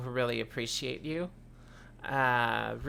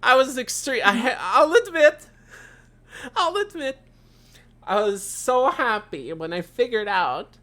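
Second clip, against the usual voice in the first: high, loud and thrilled; excited; 8 semitones higher, 7 dB louder than usual. Real, not acted. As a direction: squeal with delight about it. Gushing over bounce feature.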